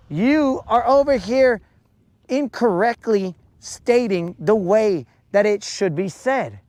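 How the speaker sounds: ordinary delivery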